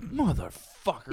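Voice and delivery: soft, slow, dismayed curse; muttered curse. Retold or imitated. Second grader seeing a dead deer instead of a popsicle, muttering. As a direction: drop it under your breath. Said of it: "So I'm like".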